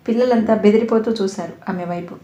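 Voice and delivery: usual speaking voice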